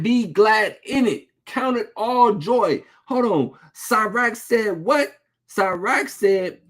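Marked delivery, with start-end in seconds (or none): none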